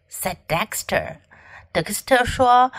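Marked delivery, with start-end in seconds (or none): none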